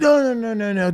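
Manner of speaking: very high run of quick denials; hurried reassurance. Retold or imitated. Producer to worried actor, hastily reassuring. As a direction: wave the worry away in a high rush.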